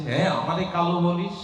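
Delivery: furious challenge to his friends; angry child shout. Acted. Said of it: "Govinda রেগে মেগে গিয়ে". (রেগে উঁচু জোরালো চিৎকার)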